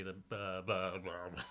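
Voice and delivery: ordinary talk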